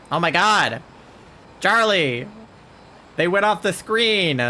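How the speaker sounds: loud, high startled outburst; excited shout. Real, not acted. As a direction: yell at the flood of spam. Chat spam floods the screen.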